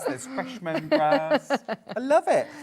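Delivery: laughing, hammed-up wine tasting; sommelier tasting notes. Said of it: "You sound like a wine expert".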